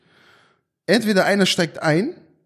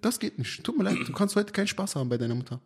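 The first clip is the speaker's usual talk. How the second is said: mock refusal; 3 semitones higher, 10 dB quieter, faster than usual. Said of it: "Sag zu deiner Frau".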